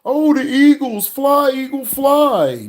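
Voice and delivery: high, loud mock fan cheer, slowed; mocking hyped fans. Mocking fantasy fans cheering, a loud high mock shout. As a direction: ham up a dumb fan yelling team chants. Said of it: "you got these idiots out here".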